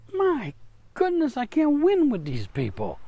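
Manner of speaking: high, weary sigh of God, voice lifting on My goodness; exasperated God. Retold or imitated. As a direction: throw up your hands at stubborn people. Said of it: "he looks at us and goes".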